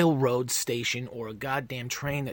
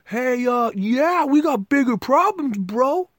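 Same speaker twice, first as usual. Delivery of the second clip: Neil deGrasse Tyson; 11 semitones higher, 10 dB louder, slower than usual. Retold or imitated. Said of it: "basically saying the same thing".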